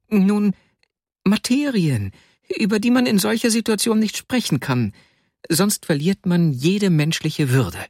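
höher, hilflos flehend; flehender Vasja. Acted. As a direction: ein schwacher Freund bettelt, höher. Vasja insists, squeezed. (Vasja fleht den Freund höher an, losgelassen zu werden)

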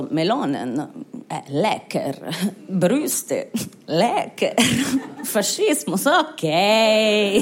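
low, slow, dim-witted mock Italian; dopey Italian voter. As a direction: drool over melons like a simpleton. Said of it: "die Italiener waren so".

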